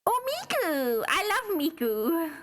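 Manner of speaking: high, adoring squeal, drawn out; gushing. Real, not acted. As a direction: gush with delight, pitched high. Gushing over Miku in the game.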